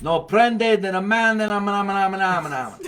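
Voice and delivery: high, loud Italian gibberish; mock Italian accent. Host tries copying the guest's Italian sentence, high loud mock Italian accent. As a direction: bungle the Italian into babble, laughing at the end. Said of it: "I like your accent".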